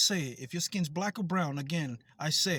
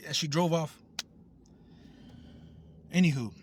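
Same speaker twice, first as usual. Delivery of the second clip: ordinary talk, nothing marked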